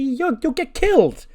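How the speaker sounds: high, loud panicked squeal; alarmed. Acted. [name] warns Saray off fighting Rhea Ripley, alarmed.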